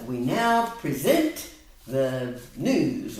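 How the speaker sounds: slow, lightly voiced mock announcer. Shown as a demonstration. Tutor playing a newsreader to show the meaning, mock. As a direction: present it like a TV host, lightly. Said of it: "to introduce something".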